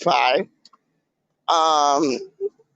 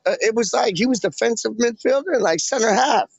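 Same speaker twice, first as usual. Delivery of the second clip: ordinary delivery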